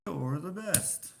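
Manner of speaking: high, drawn-out sing-song compliment; gushing praise. Pretend. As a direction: stretch it out with playful gushing. Composing a gushing line to add.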